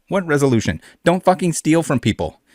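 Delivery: usual speaking voice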